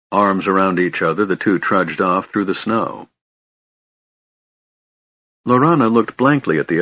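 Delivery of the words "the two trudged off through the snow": ordinary delivery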